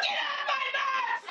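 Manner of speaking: high mock yell at the kid; high hammed-up bus driver yell; angry shout. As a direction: bark it at the fat kid. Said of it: "I'm like".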